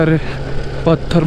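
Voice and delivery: normal talking voice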